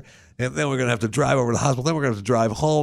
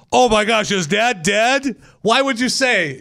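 high, startled guard's outcry; hammed-up alarm at a dead passenger; mock shock; 11 semitones higher, slower than usual. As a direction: gasp it out as the shocked border guard. Border guard reacts to dead dad.

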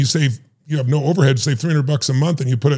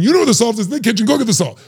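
exasperated parent; 7 semitones higher, faster than usual. Retold or imitated. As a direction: tell the kid off for not finding it.

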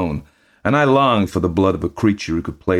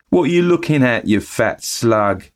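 mock taunt; 7 semitones higher, 4 dB louder than usual. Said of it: "I up and says".

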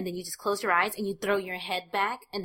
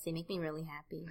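whispering; 8 dB quieter than usual. Pretend. Joking about loving pills.

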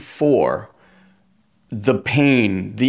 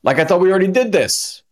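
exasperated disbelief; 8 semitones higher, faster than usual. Retold or imitated. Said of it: "you're like".

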